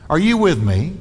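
ordinary talk, nothing marked

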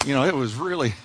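low, soft mock grumble from the pews; a low, soft imitated complaint; grumbling listener. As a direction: mutter it like a bored listener. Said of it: "some of you are going".

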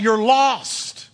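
ordinary talk

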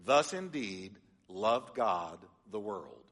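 slow, word-by-word literal reading; stiff literal reading. Shown as a demonstration. Pastor shows the congregation the clumsy literal Greek, slow and stiff. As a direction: read it slowly, each word stiff and separate. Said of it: "Here's how it would read".

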